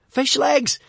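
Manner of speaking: high, loud shaky call for help; shouting. Acted. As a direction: yell for a friend in panic. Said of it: "shouted Hiccup shakily".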